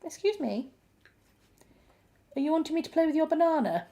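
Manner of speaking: high, sing-song question to a dog; indulgent pet talk. Real, not acted. Owner to dog wanting banana toy, high pet talk.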